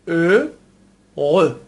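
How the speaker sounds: "ا، غ" in two slow syllables with no h; silent H. Shown as a demonstration. Teacher proves H is silent, "ا، غ" slowly split. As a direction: begin "ا" straight on the rounded vowel, then throaty "غ". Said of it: "حرف اش یا همون اچ انگلیسی رو نخوندیم".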